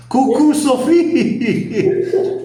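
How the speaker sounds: high, slow, sing-song "Cucu" with a laugh; playful cuckoo greeting. Shown as a demonstration. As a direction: greet her like a cuckoo clock, high and teasing. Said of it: "tem também agora cucu".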